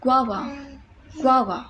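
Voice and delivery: गुआवा in one flowing word, ग्वा then वा; correct pronunciation. Shown as a demonstration. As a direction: glide गुआवा together, no break. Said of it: "गु आवा नहीं होता". (गुआवा एक साथ बहाव में)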